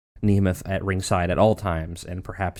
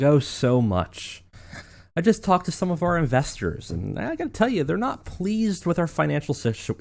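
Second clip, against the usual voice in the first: smug George; 6 semitones higher than usual. Retold or imitated. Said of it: "George cracks a smile".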